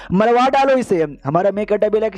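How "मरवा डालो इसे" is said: the queen sharply ordering his death; angry order. Acted.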